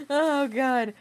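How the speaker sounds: ordinary talk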